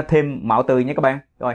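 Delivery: ordinary talk, nothing marked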